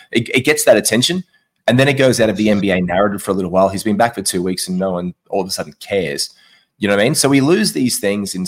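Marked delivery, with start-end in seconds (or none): none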